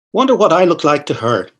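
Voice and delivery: usual speaking voice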